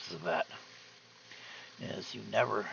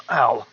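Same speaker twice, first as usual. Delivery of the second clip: sharp cough, then a pained "ow"; painful cough; 14 dB louder than usual. Real, not acted. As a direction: cough that hurts, wince after. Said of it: "It hurts to cough today".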